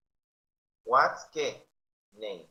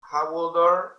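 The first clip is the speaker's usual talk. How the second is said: put-on how old are loud and higher; loud model; 5 semitones higher, 10 dB louder, slower than usual. Shown as a demonstration. Teacher drilling class, loud repeat. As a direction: call it out again.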